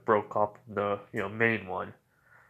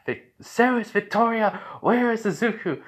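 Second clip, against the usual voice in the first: high, urgent mock yell; raised, high-pitched demand; urgent calling out; 10 semitones higher than usual. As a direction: call out sharply for someone missing. Calling out for missing Izuku.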